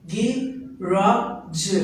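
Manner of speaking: garage drawn out very slowly, syllable by syllable; slow syllable demo. Shown as a demonstration. Teacher slowly models garage syllables for the class. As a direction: stretch out garage so each syllable is heard. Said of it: "how many syllables do we find in this word".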